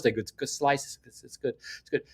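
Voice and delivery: dropping to a hushed, fond murmur about the pizza; softly moved. Real, not acted. Vlogger reminiscing about New York pizza, hushed and fond. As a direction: let the voice go soft and nostalgic, nearly to a breath. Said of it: "I'm getting a little emotional".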